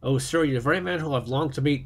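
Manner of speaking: usual speaking voice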